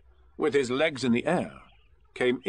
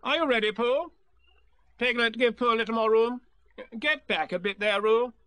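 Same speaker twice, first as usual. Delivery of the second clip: much higher, bossy organizing voice; commanding Rabbit; 9 semitones higher than usual. Acted. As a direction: Rabbit taking charge of everyone.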